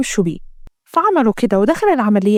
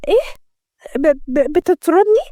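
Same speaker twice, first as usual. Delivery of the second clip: stammering, higher-pitched disbelief; shocked; 5 semitones higher, slower than usual. A narrator's reading. Maid to the boss who fires her, shocked. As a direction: stumble over the word in acted shock. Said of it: "منة بصدمة".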